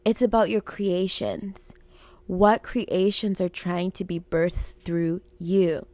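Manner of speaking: ordinary talk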